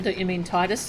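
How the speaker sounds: ordinary talk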